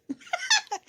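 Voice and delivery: sudden high squeal of laughter. Real, not acted. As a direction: burst out in a shrill giggle. Laughs at own joke.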